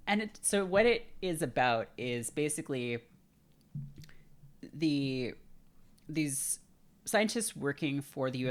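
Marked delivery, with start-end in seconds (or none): none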